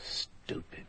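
whispered self-scolding; muttered to himself. Acted. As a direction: breathe it out under your breath, annoyed at yourself.

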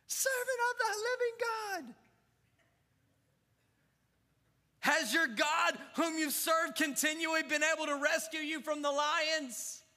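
a touch of hammed-up anguish, hoping against hope; mild anguish. Darius calling down to Daniel, mildly anguished and high. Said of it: "called out in an anguished voice".

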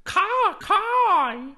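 high, loud cawing crow; crow caws. Acted. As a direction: caw a happy yes. Crow answers yes.

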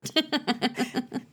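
short soft laugh; a quiet chuckle. Real, not acted. Laugh at the warning.

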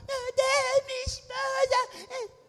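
hammed-up high crying wail, slow; mock sobbing husband. Pastor mocks grieving for a straying wife, hammed-up sob. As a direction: fake a weeping husband. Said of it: "Você acha que eu vou ficar".

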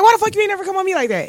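high, fast indignant question; retold demand. Woman retelling asking her ex why not her, high fast indignant. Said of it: "And I asked, like".

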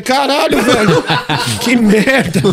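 high, loud mock horror; hammed-up dismayed outburst; appalled exclamation. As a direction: cry out in comic disgust. Acted disgusted realisation for laughs.

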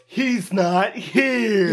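very slow, high, loud bellow; hammed-up drawn-out shouting; mock furious scream. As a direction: stretch every word into a comic villain yell. Imitates Larry screaming back.

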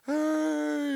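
put-on very high, loud drunken yell; drunk shout. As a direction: bellow it across the room while pointing. Drunk boss yelling from stage.

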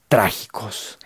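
whispered trágicos. Shown as a demonstration. Speaker telling viewers about tragic thoughts, whispered. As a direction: breathe the word trágicos as a whisper. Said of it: "whispered word".

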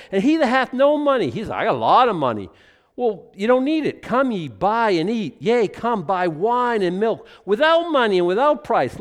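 loud, high preaching read, every line pushed out; loud, high preacher's scripture reading; fervent scripture reading. Acted. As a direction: proclaim it to the whole room. Reading scripture aloud to congregation.

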